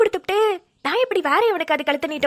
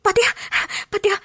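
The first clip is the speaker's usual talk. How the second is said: tearful; 3 semitones higher than usual. Acted. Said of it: "தேம்பி தேம்பி அழுவுறவ".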